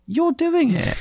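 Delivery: high, startled "you're doing it"; mock surprise. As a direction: catch him out in mock disbelief. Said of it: "you're like".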